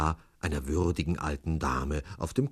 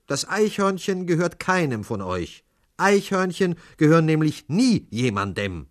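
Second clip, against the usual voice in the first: raised, emphatic higher voice; high, insistent correction; stationmaster setting people straight; 11 semitones higher, 7 dB louder than usual. A narrator's reading. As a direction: lecture the crowd loudly and firmly. Stationmaster lectures the quarrelling passengers.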